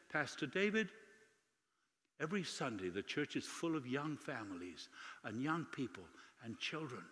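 softly retold, touch of emotion; hushed, moved testimony in her words; tearful old lady. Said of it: "she started to cry".

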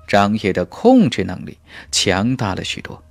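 plain reading voice

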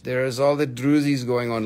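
ordinary talk

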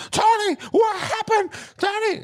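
high shrill scream of a name; screaming mom. Retold or imitated. Mom screaming her son's name from the hallway, loud and high. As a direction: mom screaming for her son after a crash.

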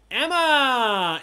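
name called high, loud and long; announcer call-out. Real, not acted. Host reading standings to players, excited announcer shout. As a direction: call out the leader like an arena announcer.

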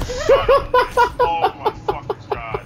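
loud, high-pitched cursing; angry outburst. Real, not acted. Gamer cursing after losing in the game, angry outburst. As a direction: swear at the top of your voice, really angry. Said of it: "I'm not even fucking happy".

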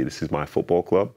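ordinary talk

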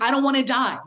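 ordinary talk

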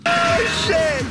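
high, loud alarmed cry; startled shout. Real, not acted. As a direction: blurt it out in shock. Sudden danger in game.

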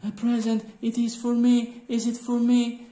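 put-on high, eager little girl asking for a present. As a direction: pitch way up like a hopeful small child.

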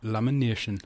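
LAMINATION in a put-on Geordie accent. Shown as a demonstration. Showing off a good Geordie word, "Lamination" in Geordie accent. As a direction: say "Lamination" Geordie-style, the "-na-" broken into "nee-uh". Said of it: "That's a good word for Geordie".

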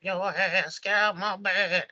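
high, put-on quavery singer voice; singer impression. Singer finds his wife with lover, high imitation voice. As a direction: do the singer confronting someone, pitched high. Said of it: "that's the best I could do".

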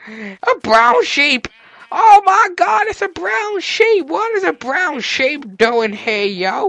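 slow, high, loud exclaiming; astonished excitement. Real, not acted. Player discovering a rare brown sheep, loud high astonishment. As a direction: belt out every word in amazement.

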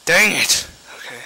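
ordinary delivery

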